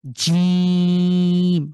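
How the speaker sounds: জিম held long and loud with the tongue middle locked hard on the palate; hard jim. Shown as a demonstration. Teacher demonstrating a strong locked জিম to students. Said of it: "শক্ত করে জিভের মাঝখানটা ... strongly lock".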